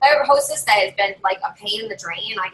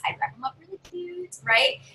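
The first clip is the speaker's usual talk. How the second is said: ordinary talk, nothing marked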